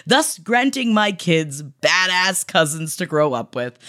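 normal talking voice

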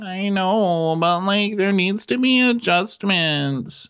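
high-pitched whiny insistence; put-on high nagging voice; mock pleading girlfriend. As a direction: whine it high, drawing out the last words. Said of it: "And she's like".

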